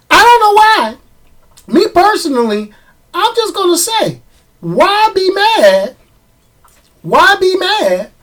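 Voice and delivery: high-pitched questioning, drawing out "why be mad"; incredulous high pitch. Real, not acted. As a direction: voice leaps way up. Exasperated high-pitched plea.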